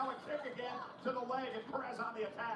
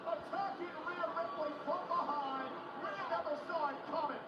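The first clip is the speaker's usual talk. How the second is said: rising, hyped play-by-play call; excited call; 7 semitones higher than usual. Real, not acted. Surprise attack call.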